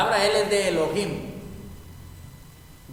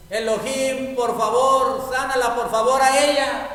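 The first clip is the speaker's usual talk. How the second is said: higher, louder urgent plea; Moses pleading; 6 semitones higher, 5 dB louder than usual. Acted. Moses praying for Miriam in Spanish, urgent higher plea. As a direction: beg God for her healing, pushing each por favor. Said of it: "Eso fue todo lo que oró".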